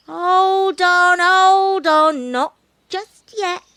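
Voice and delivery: high, loud monkey voice, drawn-out words; slow the pleading kitten right down, loudly; stalling monkey. Acted. Monkey holding off the begging bush cat, stalling.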